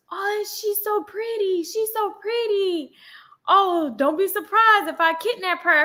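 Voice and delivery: slow, high imitating a creepy stranger; mock creepy passenger; creepy man. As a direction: drawl it slowly like a creep.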